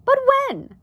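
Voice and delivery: very high, loud question; alarmed question. A narrator's reading. Wife presses husband for an answer, alarmed question. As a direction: leap up in astonishment. Homley repeats in disbelief.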